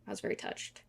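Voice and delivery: whispered, barely there. Real, not acted. Confessing to viewers, a whisper. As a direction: breathe it out, no voice.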